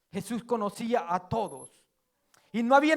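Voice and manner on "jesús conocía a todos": quiet repeat. Shown as a demonstration. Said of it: "porque Jesús conocía a todos".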